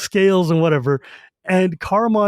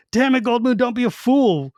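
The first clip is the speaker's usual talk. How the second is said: a sharp, higher-pitched retort aimed at Goldmoon; snapping; 6 semitones higher than usual. A narrator's reading. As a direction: bite it off at her, irritated. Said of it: "snaps at her".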